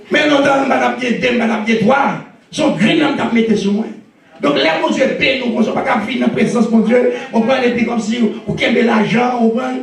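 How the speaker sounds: sermon exalté, voix plus haute; prêche enflammé. Acted. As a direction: relance la ferveur devant l'assemblée. Preaching builds again. (le pasteur reprend son prêche avec ferveur)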